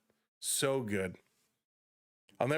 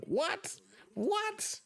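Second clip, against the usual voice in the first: very high squeaky double take; disbelieving, rising squeaks; shocked what. Retold or imitated. Recalling his shock at Paul Bearer's normal voice, squeaky surprise.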